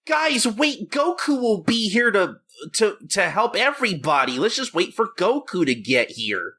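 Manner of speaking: high, pleading mock voice, falling back toward normal; mock whiny ally. Friend mocking Dragon Ball sidekicks waiting for Goku, whiny high voice. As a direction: whine to the group like a helpless sidekick.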